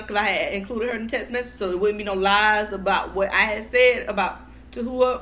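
ordinary delivery

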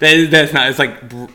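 ordinary talk

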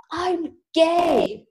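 high, drawn-out mock panicked cry; terrified confession. Scared bandmate confesses on a falling plane, high mock-terrified cry. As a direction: blurt it high, as if death is imminent. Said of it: "like, scared and terrified".

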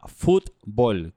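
"fútbol" slowly, first syllable stressed on the U. Shown as a demonstration. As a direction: spell out "fútbol" slowly, leaning on FÚT. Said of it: "con acento en la U". ("fútbol" despacio, con acento en la U)

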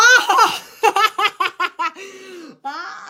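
high shriek of laughter; long, high cackle; mocking laugh. Real, not acted. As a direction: burst out cackling at the boast. Cracks up at partner's boast.

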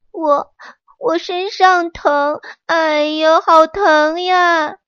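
high, slow, aching moans of a sick tree; in pain. Acted. Sick peach tree telling the monkey where it hurts, groaning. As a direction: stretch each word out like it hurts. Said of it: "桃树疼得呀".

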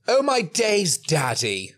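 high, slow, hammed-up posh kid; posh accent. Listener mimicking the kid for a laugh, mock posh accent. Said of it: "other posh things".

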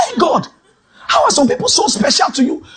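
high, laughing shout at God; laughing complaint. Retold or imitated. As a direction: laugh while protesting to God. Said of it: "I said".

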